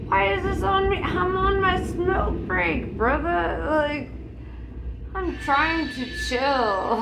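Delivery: high, loud exasperated complaint. Retold or imitated. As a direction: whine it out, fed up. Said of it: "And I'm like".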